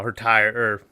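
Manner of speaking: half-whispered slip, quickly corrected; slip-of-the-tongue mutter. Real, not acted. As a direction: mutter the wrong word under your breath. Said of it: "I've been saying tire so much".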